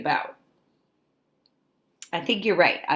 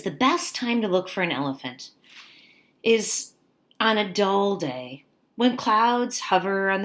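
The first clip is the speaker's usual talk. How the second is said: bright storybook read-aloud; picture book read to kids; reading to children; 2 semitones higher than usual. Acted. Teacher reads picture book aloud.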